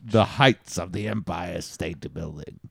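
low, grand put-on voice; Star Wars villain. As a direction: intone it like a space-saga villain, low. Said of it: "Now you sounded like Star Wars".

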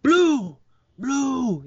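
high, loud calls of the name; sharp high calls to a raptor; calling out. Retold or imitated. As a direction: call out across the paddock. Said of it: "he's like".

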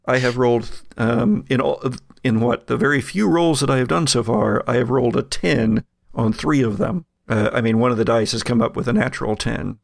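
ordinary talk

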